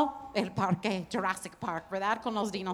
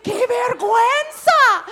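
higher, loud, slow wail; wailing shame; 7 semitones higher, 6 dB louder, slower than usual. Retold or imitated. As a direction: cry out in embarrassment. Avergonzada a la mañana. (lamento fuerte de vergüenza)

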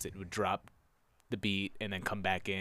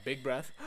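big gasp in; 5 dB quieter than usual. Shown as a demonstration. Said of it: "this is what you do".

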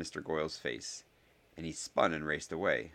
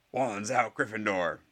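raised, put-on rallying call; battle cry; 4 semitones higher, 4 dB louder, slower than usual. A narrator's reading. Gryffindor joins the standoff, raised rallying cry. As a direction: call the house to arms.

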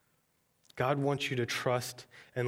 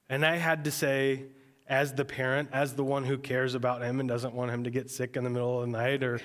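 ordinary talk, nothing marked

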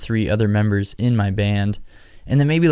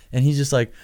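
ordinary talk

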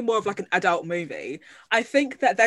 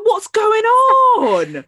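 loud, high, drawn-out wail of disbelief; exasperated outcry; 12 semitones higher, 9 dB louder, much slower than usual. Retold or imitated. Host retelling her reaction to the trailer, exasperated cry. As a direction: wail it slowly, hamming up the bafflement. Acts out own baffled reaction.

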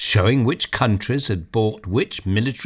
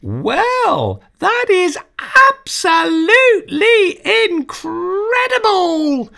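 put-on much higher, slow amazed girl's cry; girl gasping at the screen, much higher and excited; loud excited; 4 dB louder, slower than usual. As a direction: squeal out the amazement. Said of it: "with a loud, excited voice".

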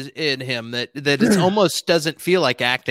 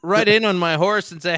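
usual speaking voice

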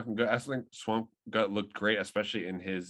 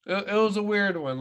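excited; 10 semitones higher, 6 dB louder than usual. Real, not acted. Excited overlapping reaction.

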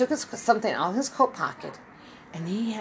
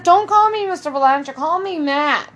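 louder and higher, pushing back; insist, don't let him say Mister; insistent protest; 7 semitones higher, 9 dB louder than usual. Retold or imitated. Matt insists on his name.

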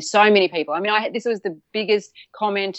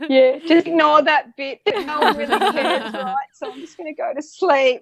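higher, laughing through the words; 9 semitones higher, 4 dB louder than usual. Real, not acted. Woman laughing at her own late-night video, laughing.

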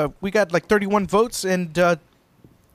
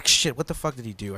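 ordinary talk

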